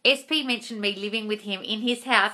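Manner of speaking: ordinary talk, nothing marked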